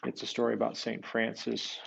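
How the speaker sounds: plain reading voice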